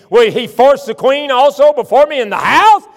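loud, high, outraged; king catching Haman with the queen, furious; enraged king. Acted. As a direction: bellow in outrage. King finds Haman on bed.

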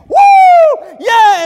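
very high, full-out cheering shout; roaring crowd. Retold or imitated. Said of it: "the crowd was roaring".

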